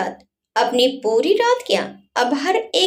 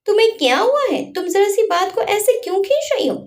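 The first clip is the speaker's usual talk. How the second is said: exasperated; 6 semitones higher than usual. Acted. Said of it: "Adhiraj परेशान होते हुए बोला".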